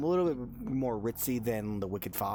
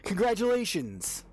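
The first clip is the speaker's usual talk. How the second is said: higher-pitched congratulations in character; congratulating; 9 semitones higher, 4 dB louder than usual. Acted. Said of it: "He goes".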